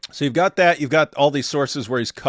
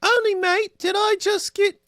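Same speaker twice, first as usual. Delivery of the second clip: high mock British girl accent, "mate" chirped; 4 dB louder than usual. Reading a chat name, high British girl accent. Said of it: "British girl".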